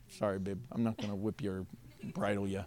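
low, soft aside; quiet sheepish reassurance; hushed apology. Real, not acted. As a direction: apologize under your breath, pitch dropped. Aside apologizing to his volunteer.

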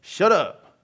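loud, curt order; stern telling-off. Acted. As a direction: shush someone sternly, playing the crowd.

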